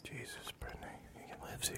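hushed scolding whisper. Real, not acted. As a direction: whisper it so he won't hear. Hushed scolding about host.

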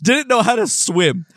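can barely get it out for laughing; laughing while telling. Real, not acted. Laughing while retelling funny video.

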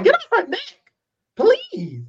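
exasperated high plea. Real, not acted. Exasperated at Peter.

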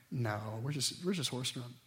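put-on calm a kid at bedtime; quiet dad soothing his daughter; reassuring parent. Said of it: "parent reassures child".

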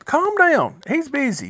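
high, mock-impatient shushing of a noisy man; hushing crowd. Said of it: "I'm paraphrasing a little bit".

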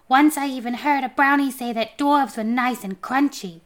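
taunting homunculus. A narrator's reading. Homunculus scaring the dwarf, lightly taunting.

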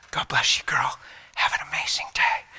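whispering a blessing; whispered blessing. Retold or imitated. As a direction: breathe it out, barely voiced.

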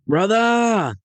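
a high, ringing call across the cockpit; excited yell. Retold or imitated. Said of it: "I yelled to my brother".

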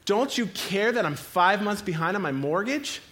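half-shouted frantic demand; acted screaming out. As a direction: cry out to God in mock panic. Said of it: "We start screaming out".

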